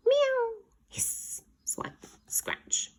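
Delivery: high meow then breathy hissed effects; cat attack effects. Acted. Cats scratching the dog, meow and hisses. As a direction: play the cat fight.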